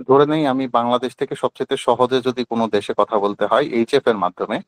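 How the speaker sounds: usual speaking voice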